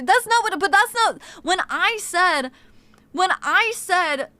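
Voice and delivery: ordinary delivery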